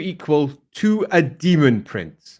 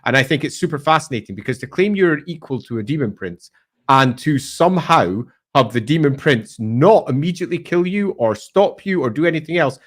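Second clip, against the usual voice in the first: ordinary talk, nothing marked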